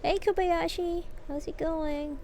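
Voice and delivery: ordinary talk, nothing marked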